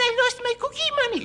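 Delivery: put-on high squeaky little girl voice. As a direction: pipe it up like a small girl.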